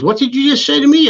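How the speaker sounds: retelling a startled promoter, pitched high. Retold or imitated. Retelling a promoter startled at being asked for money, mock startled high voice. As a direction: imitate a promoter caught off guard by the demand. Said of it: "And he was startled".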